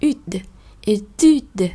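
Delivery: usual speaking voice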